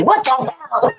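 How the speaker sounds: high-pitched imitated outburst, amused; hammed-up husband's exclamation; mock exasperated husband. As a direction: play the husband's disbelief way up high.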